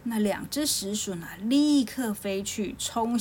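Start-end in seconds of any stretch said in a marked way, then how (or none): none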